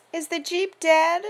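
put-on high, slow, quavering little girl voice; about to cry. A narrator's reading. Emily, a small girl, asking about the Jeep near tears. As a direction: let it wobble as if tears are coming. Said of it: "in that voice that tells you she's about to cry".

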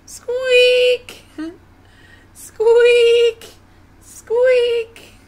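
very high, very loud squeaks; piercing, forceful mousy squeaks; mouse squeak. Acted. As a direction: squeak each word as hard as possible. Mouse tries, squeaks loudly.

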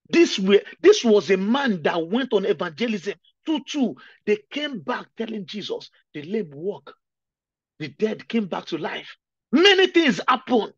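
fervent preaching. Real, not acted. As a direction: build it with fire.

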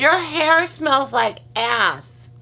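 high, slow teasing jab; playful tease. Real, not acted. Teasing her partner about his hair, high and slow. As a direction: needle him about the smell.

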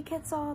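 Quetzal said slowly as KET-sal, each sound clear; careful name. Shown as a demonstration. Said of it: "apologize to Quetzal".

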